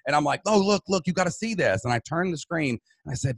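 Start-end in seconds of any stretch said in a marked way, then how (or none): 0.4-1.8 high, eager insistence; excited, pitched well up; retold excited plea. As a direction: re-enact begging someone to come and look. Retold excited plea to his wife.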